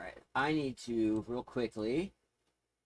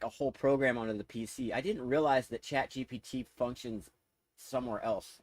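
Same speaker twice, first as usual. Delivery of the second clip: normal talking voice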